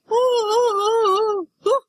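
high, hooting howl; imitated dog howl. Teller imitates what Mom heard at night, high hooting howls. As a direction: howl "ooh" in short high bursts. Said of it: "Mom heard this".

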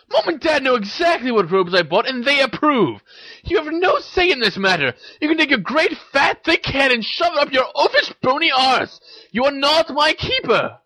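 high, heated sisterly rant; screaming tirade. A narrator's reading. Ginny tells Ron he has no say, screaming angrily. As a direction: rail at your brother at high pitch. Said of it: "screamed Ginny".

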